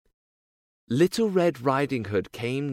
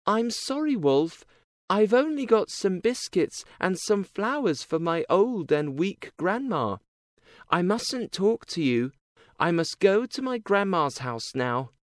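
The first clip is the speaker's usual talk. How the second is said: put-on high, nervous little girl; frightened girl; 7 semitones higher than usual. A narrator's reading. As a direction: voice the scared girl, higher.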